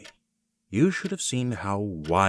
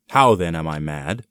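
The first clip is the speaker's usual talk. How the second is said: louder, challenging the listener; defiant outburst; 8 dB louder than usual. Acted. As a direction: throw the question at them like an accusation. Madman insists he is sane.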